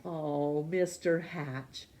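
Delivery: plain reading voice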